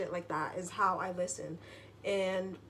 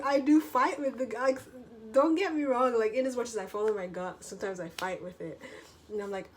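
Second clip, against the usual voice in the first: normal talking voice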